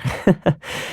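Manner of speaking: soft, short laugh; rueful chuckle. Acted. Recalling once being unwelcome, soft rueful chuckle. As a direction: laugh it off softly.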